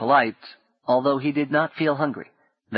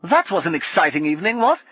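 cheery call; 6 semitones higher, 4 dB louder than usual. Acted. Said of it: "he called up to Paul".

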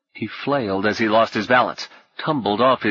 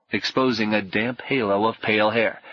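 usual speaking voice

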